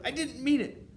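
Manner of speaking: very high, loud pleading; grovelling underling witch; miserable cry. A narrator's reading. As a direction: beg forgiveness in a panicked squeal. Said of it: "cried the miserable culprit".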